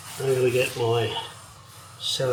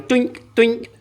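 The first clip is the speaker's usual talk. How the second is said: playful doink noises; 10 semitones higher, 4 dB louder than usual. Pretend. Imitating a part swinging down.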